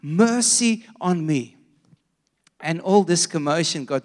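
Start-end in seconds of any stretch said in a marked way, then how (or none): 0.0-1.6 high, drawn-out calling; mock yell, slowing on the plea; retold louder yell. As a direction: imitate an insistent cry for mercy. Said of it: "and he yelled louder".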